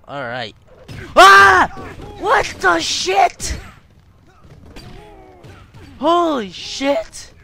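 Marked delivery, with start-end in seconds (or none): 1.1-1.7 sudden high, very loud yelp; startled yell. Real, not acted. Player jolted by the game, startled yell. 2.2-7.3 high, drawn-out startled shout; shocked shout. Real, not acted. Player caught off guard by the game, shocked shout.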